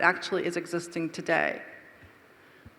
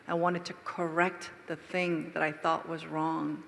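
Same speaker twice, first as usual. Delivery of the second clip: normal talking voice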